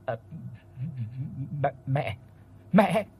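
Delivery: halting, stuttered words of shock; frightened stammer; stammering in terror. A narrator's reading. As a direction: force out each word through fear. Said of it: "mãi mới bật ra được vài chữ".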